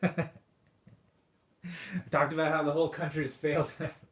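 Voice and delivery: laughing through the words. Real, not acted. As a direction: laugh as you say it. Laughs at own rant.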